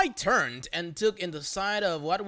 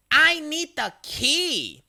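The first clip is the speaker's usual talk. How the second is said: loud, higher, very slow demand; shouting; 9 semitones higher, 6 dB louder, much slower than usual. Retold or imitated. Karen to narrator at Panda Express, shouting. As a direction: shout it, out of patience. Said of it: "Karen, shouting".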